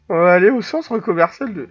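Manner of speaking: put-on voice-breaking teenager; teen with breaking voice. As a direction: crack upward like a pubescent teen. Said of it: "là, je fais l'ado qui mue".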